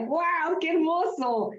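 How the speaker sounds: high-pitched delighted exclamation; delighted awe. Real, not acted. Teacher marvels at her audience's lovely answers, delighted awe.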